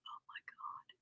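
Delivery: ordinary talk, nothing marked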